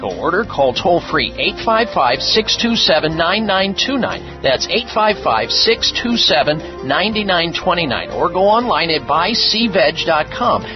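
radio ad read over music; infomercial. Acted. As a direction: pitch the product to listeners. Host reads his own ad.

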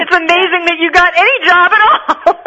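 said through laughter, high and bright; giggling joke to her guest; laughing. Real, not acted. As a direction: tease her while laughing. Host jokes, laughing.

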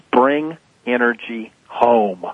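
like a motto to remember; slow emphatic slogan. Shown as a demonstration. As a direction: Bring energy home, slowly, word by word. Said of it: "here are my favorite three words".

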